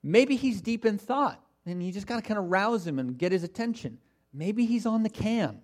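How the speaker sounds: sing-song sarcastic guesswork; mocking. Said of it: "he's making fun of them".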